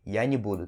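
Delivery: не буду as a joined "ni BU-du". Shown as a demonstration. Teacher repeats the everyday form, не pronounced "ni". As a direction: reduce the vowel of не to i. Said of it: "No dia a dia a gente pronuncia assim".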